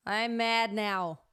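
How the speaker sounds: slow, loud mock anger; mad. Shown as a demonstration. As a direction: bark it like you're really mad. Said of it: "as if you were really mad".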